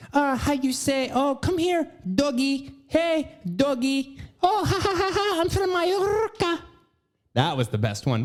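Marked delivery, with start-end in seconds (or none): 0.1-6.6 very high, hammed-up Spanish accent with fake laugh; Hilaria's Spanish accent. Comedian mocking actor's wife calling a doggy, hammed-up Spanish accent. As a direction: climb into a squealing fake Spanish accent, rolling the r. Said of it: "The Spanish accent, which was cute".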